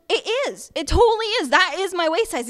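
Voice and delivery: high-pitched mock denial, insisting; indignant woman. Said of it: "She goes".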